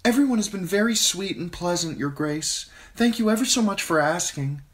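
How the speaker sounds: put-on high, bright girlish voice, very proper; a well-mannered girl thanking a queen; polite young girl. A narrator's reading. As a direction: Sansa answering the queen politely. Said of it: "Sansa said politely".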